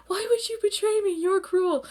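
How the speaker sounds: high put-on wounded voice; hammed-up reproach in a high voice; mock hurt accusation. Acting Akito's accusation of betrayal.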